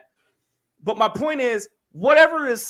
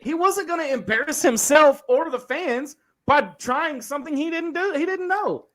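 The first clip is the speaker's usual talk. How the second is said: rising, louder heated defense; 8 semitones higher, 3 dB louder than usual. Real, not acted. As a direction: argue it hard, voice climbing.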